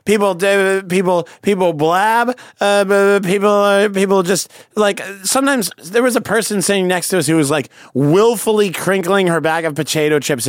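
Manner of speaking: sputtering, high-pitched rant; worked up and tripping over the words; ranty. Real, not acted. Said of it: "yours was so ranty".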